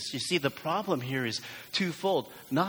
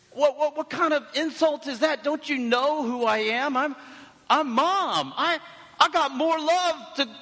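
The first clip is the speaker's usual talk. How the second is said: high, loud, indignant mom; outraged mother voice, pitched way up; insulted mom; 13 semitones higher, 5 dB louder than usual. Acted. Mom scolding her son for doubting her love, hammed-up indignation. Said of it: "Daniel, to your mom?".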